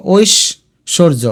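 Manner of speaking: Aishwarya slowly as oish, shor-jo; broken into syllables. Shown as a demonstration. Teacher has students sound it out, Aishwarya slowly in parts. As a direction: pause after the first syllable of Aishwarya. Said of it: "ঠোঁটে উচ্চারণ করে দেখো".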